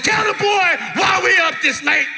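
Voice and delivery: high, loud scolding shout; imitated mom yelling; fed-up mother. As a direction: order the child impatiently, hammed-up. Fed-up mother scolds crying daughter.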